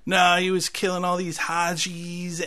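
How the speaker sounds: put-on kid voice, stretched out; kid's retort. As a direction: imitate a kid butting in, slow and high. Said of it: "And then his kid's like".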